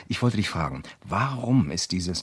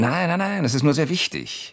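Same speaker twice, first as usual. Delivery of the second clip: hasty denial; 9 semitones higher than usual. Acted. Hastily denying danger.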